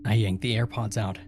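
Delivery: ordinary delivery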